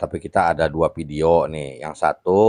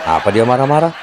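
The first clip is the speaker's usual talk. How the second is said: amused surprise; 6 semitones higher, 8 dB louder, faster than usual. Real, not acted. Listener amazed at the story.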